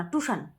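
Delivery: "টিউশন" said as "tushan", no i-glide; grandfather's pronunciation. Retold or imitated. Vlogger recalls grandfather's "টিউশন", said as tushan. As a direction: say "টিউশন" short, as tu-shan. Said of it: "টিউশন বলতো না".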